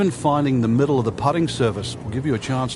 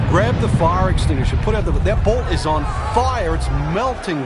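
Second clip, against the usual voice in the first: excited; 5 semitones higher, 4 dB louder than usual. Real, not acted. Commentator hyped by big drive.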